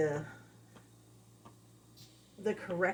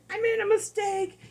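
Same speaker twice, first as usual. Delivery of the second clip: high, loud guilty pup; guilty dog voice; 14 semitones higher, 7 dB louder than usual. Retold or imitated. The dog stuck on its mistake, high guilty whine. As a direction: play the dog fretting over its mistake.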